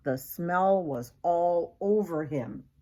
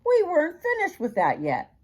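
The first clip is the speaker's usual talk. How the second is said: high, raised protest of an annoyed camper; 11 semitones higher, 4 dB louder than usual. A narrator's reading. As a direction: scold the little monkey for spoiling the fire. Camper scolds George.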